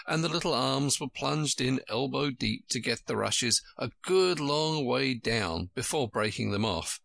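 ordinary delivery, nothing marked

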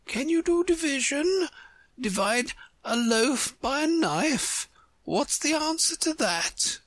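high-pitched queen posing a sum. Acted.

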